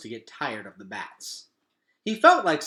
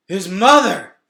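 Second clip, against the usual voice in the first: suddenly remember something awful; gasping alarm; 7 semitones higher, 15 dB louder, slower than usual. A narrator's reading. Gregor panics remembering his mother at home. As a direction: gasp then louder panicked cry.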